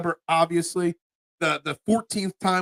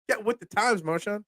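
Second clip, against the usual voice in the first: ordinary talk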